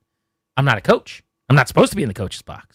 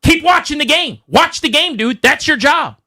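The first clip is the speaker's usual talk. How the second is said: much higher mock shout at the referee; retold yelling back; 10 semitones higher than usual. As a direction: fire it back at the ref, pointing. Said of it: "yelling as, like, the play's going on".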